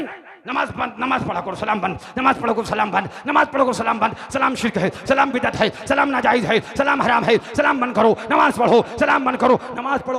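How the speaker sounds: rapid-fire loud tirade of orders; hammered, relentless shouting; frightening yelling. Acted. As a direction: yell commands over and over to scare him. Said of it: "इतना जोर से चिल्लाए कि बेचारा डर गया".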